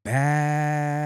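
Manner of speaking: long, level, drawn-out vowel; drawn-out emphasis. Real, not acted. Streamer praising India Love's looks, drawn-out emphasis. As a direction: stretch the word out long on one held note.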